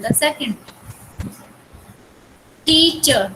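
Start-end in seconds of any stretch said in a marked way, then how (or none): 2.7-3.3 teacher with more force on टी; stress on first syllable. Shown as a demonstration. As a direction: lean on टी in teacher, ease off चर. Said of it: "टी पे ज्यादा जोर दे रहे हैं".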